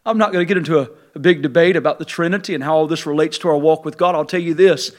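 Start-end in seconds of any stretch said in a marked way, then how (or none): none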